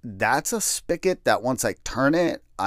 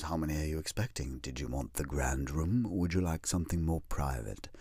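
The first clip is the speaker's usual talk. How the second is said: soft, hushed, somber salesman; hushed funeral director; 6 semitones lower, 10 dB quieter than usual. Retold or imitated. Funeral director to grieving family, in a hushed softer voice. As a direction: speak low like you fear waking the dead. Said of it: "they all talk like that".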